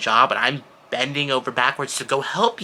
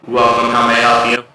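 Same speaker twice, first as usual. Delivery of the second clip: loud monotone robot; mock a robot greeter, loudly; droning mechanical greeting at full volume; 10 dB louder than usual. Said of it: "so you want a robot?".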